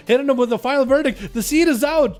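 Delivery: excited play-by-play. Real, not acted. Caster to viewers as a seed appears, excited. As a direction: call the big moment as it happens. Calling the climax of a match.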